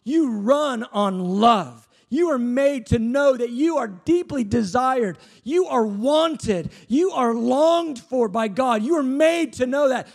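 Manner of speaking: high, slow, emphatic preaching; impassioned preaching. Acted. Preacher pressing the crowd with God's longing, impassioned. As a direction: preach it slow and heavy, every word landing.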